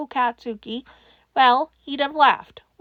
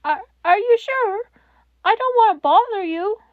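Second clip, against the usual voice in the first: put-on timid child; 8 semitones higher, 5 dB louder than usual. A narrator's reading. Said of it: "scared child caller voiced".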